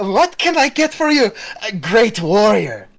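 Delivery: high, bright mock-grand greeting; cheery barmaid greeting. Barmaid hailing the nervous kobold, loudly cheerful. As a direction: greet the little warrior with high, loud cheer. Saris writes a greeting on board.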